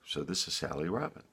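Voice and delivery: ordinary delivery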